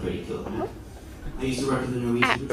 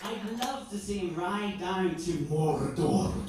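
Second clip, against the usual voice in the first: ordinary talk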